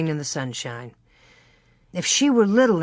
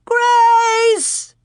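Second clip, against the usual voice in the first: long, high, loud call; mother calling across the slough; shrill call; 7 dB louder than usual. A narrator's reading. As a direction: hold the name out far. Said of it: "Ma's shrill call".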